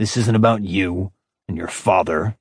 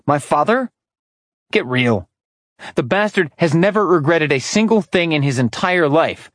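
higher, bitter sneer at the father; scornful disbelief; 6 semitones higher than usual. A narrator's reading. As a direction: dismiss the idea with contempt. Orion scorns his father.

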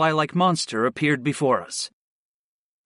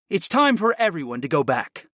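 ordinary delivery

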